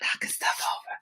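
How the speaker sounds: hushed whisper after knocking things over. Real, not acted. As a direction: whisper it under your breath. Knocks things over on live stream.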